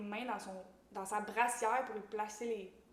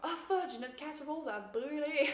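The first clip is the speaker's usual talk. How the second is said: mock panic; 5 semitones higher, 3 dB louder than usual. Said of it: "ils sont comme".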